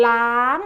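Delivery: ล้าง held long, then lifting at the end; high tone. Shown as a demonstration. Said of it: "is a high tone".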